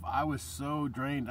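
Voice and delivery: ordinary delivery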